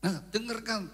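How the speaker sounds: normal talking voice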